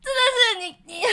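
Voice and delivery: high, loud, near-crying exasperation; exasperated inner cry. Retold or imitated. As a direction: burst out in disbelief. Inner exasperation at noisy man. (崩溃般地尖声抱怨)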